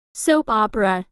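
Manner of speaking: ordinary talk, nothing marked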